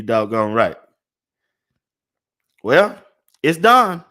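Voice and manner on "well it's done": excited announcement. Real, not acted. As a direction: call it out like a win. Announcing the finished order.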